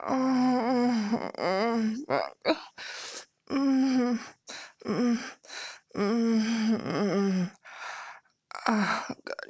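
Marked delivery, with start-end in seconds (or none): 0.1-7.4 aroused moans. Acted. Aroused moaning mid-roleplay.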